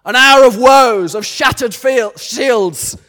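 loud, slow battle cry speech; rally the troops before battle; roaring rallying speech. Retold or imitated. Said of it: "he says this".

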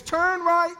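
high, sustained sung-out note; imitated auto-tune GPS. God answering the worried believer like a GPS, high sing-song. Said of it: "All God is saying is".

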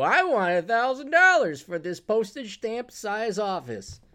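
mocking greedy landlord. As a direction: mimic a landlord demanding too much. Mock quote of greedy landlords.

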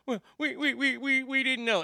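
high, stammering mock excuse; flustered office mate. Imitates the flustered office mate's excuse.

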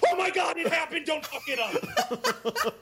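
panicked inner voice, laughing. Retold or imitated. Actor recalling his inner panic on set, laughing. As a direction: recall the terror of the take while giggling. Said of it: "I went directly in my head with".

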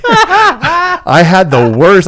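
stretched out through a laugh; laughing, very drawn out. Real, not acted. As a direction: drag the words while laughing. Laughing while retelling worry.

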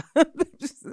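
a burst of high, loud laughter; real laugh. Real, not acted. Guest remembering the conversation, bursting into laughter. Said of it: "I'm laughing 'cause I remember".

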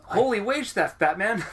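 high, loud sidekick exclaim; Robin-style exclamation. Pretend. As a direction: blurt it like Batman's sidekick. Robin catchphrase parody.

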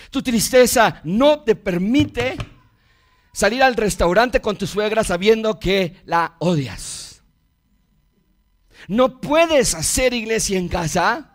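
impassioned sermon, drawing out key words; forceful preaching. Real, not acted.

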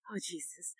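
breathless frightened whisper; terrified hushed whisper at the top of the slide; scared whisper. Retold or imitated. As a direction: gasp it out in terror, barely voiced. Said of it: "I feel myself getting anxiety right now".

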